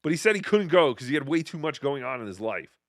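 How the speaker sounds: ordinary talk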